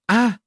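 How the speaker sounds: high, loud miserable wail; burning scream. Acted. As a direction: scream out in searing pain. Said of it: "tiếng của tiểu mập mạp kêu gào thảm thiết".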